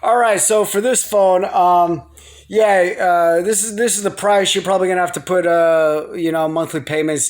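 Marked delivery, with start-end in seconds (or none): none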